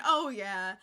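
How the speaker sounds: put-on light Minnesotan accent, low and loud. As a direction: Minnesotan accent on a hearty affirmation. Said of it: "The Minnesotan accent".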